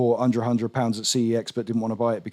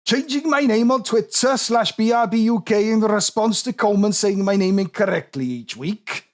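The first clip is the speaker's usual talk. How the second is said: put-on higher, lilting Welsh accent; 8 semitones higher than usual. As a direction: read it in a sing-song Welsh accent. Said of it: "I can do a respectful Welsh accent".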